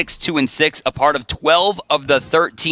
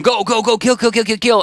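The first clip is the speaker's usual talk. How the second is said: higher, excited mock callouts; imitating team comms; 9 semitones higher than usual. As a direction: rattle off the comms like the players. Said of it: "They're talking, like".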